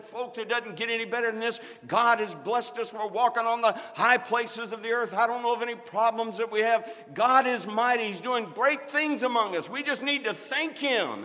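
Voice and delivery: loud, high, triumphant announcement to the church; rousing proclamation. Retold or imitated. Pastor declaring to the congregation, loud and triumphant. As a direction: lift the whole room with it.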